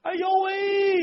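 high, shrill exclamation; dismayed cry. Acted. Old wife's exclamation of dismay.

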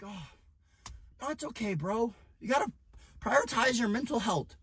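soft, mocking coddling voice; mocking gentle bro. Mocking bros who coddle friends, in a soft mock voice. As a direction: parody a gentle, supportive friend.

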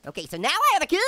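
ordinary talk, nothing marked

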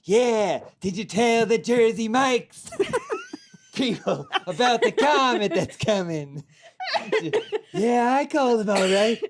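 celebrity phone voice with giggles; Jack Nicholson. Acted. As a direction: juggle two star impressions, chuckling. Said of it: "It's Jack".

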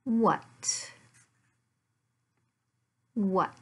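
usual speaking voice

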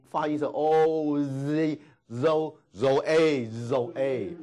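zoe broken into zo- then the whole two-syllable zoe, very slow; slow syllable demo. Shown as a demonstration. Teacher showing students how zoe is pronounced, very slow. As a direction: build zoe up sound by sound.

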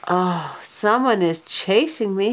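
plain reading voice

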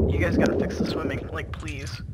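whispered plea; whispering. Real, not acted. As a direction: breathe it, no voice. Pleading aside to game developer.

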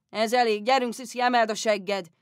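loud, coarse fatherly order; father shouting. Retold or imitated. Father ordering daughter off her lessons, loud. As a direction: bellow it to get her moving. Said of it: "apa kimondta".

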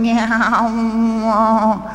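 high, plaintive meow of a sick cat; imitating a miserable cat. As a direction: wail it like a cat in pain. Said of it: "the cat was going".

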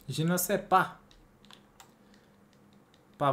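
ordinary delivery

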